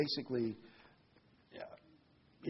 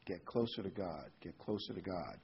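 soft, dropping repeats of get closer to God; fading softer repeat; 5 semitones lower, 8 dB quieter than usual. Real, not acted. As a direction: let each repeat sink softer and lower. Repeated exhortation, fading away.

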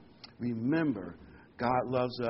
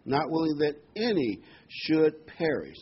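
"any" set apart and stressed, higher pitch; emphatic stress on any; 4 semitones higher, 4 dB louder than usual. Real, not acted. Preacher stressing a word of scripture, emphatic on "any". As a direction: lean hard on "any" in the verse. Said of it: "I want to emphasize that word".

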